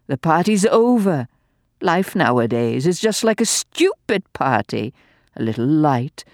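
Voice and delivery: gleeful, venomous dowager mocking the doctor; venomous old lady. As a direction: taunt him with malicious glee. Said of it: "doubled up with malicious glee".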